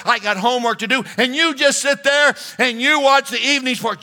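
high, loud, rushed wife's rant; complaining wife. Acted. Pastor voicing a wife venting to her husband, high and loud. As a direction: pour out the wife's grievances, high and fast.

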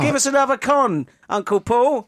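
put-on high, loud childish plea; mock child begging. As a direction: beg like a little kid.

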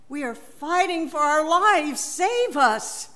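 desperate cry. Acted. Followers begging their teacher to save them, desperate cry. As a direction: plead to be saved from sinking. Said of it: "they cried out".

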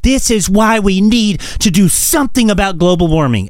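high put-on voice imitating climate worriers; mock preachy protest. Mimicking everyone who blames global warming, mock preachy. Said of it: "everyone's gonna be like".

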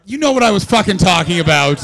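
mock outrage at being corrected; indignant snap-back. Comic to a crowd correcting his steam claim, indignant. Bristles at audience correcting him.

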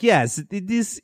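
high-pitched mock Polish accent; botched Polish accent. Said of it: "I was doing a better accent of him earlier".

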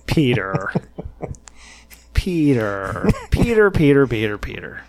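exaggerated, patronizing repeats of the name; mock an official talking down, name after name; condescending. Press secretary stalling a reporter's question, condescending. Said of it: "smug, condescending tone to him".

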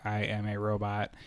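put-on low, quiet kid robot voice; flat little robot talk. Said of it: "doing his robot voice".

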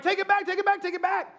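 high, repeated frantic plea; mock panic. Novice hands plane back to pilot, panicked.

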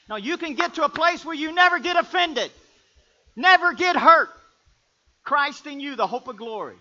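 shouted preaching, voice up high; preaching at full force. Acted. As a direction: build the crowd with a full-throated preach.

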